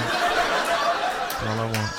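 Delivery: drawn-out, pleading and low; slow wistful repeat. Acted. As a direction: stretch it out longingly.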